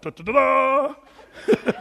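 loud, drawn-out sung-out fanfare; mock heroic fanfare. Husband heralding his wife stepping up, mock heroic. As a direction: trumpet her entrance with da-da-daaa.

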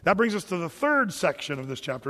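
ordinary talk

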